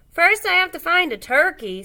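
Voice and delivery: loud, lifted moose voice; bold moose. A narrator's reading. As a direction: boom it out like a proud moose.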